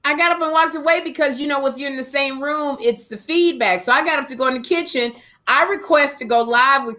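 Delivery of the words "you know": ordinary talk, nothing marked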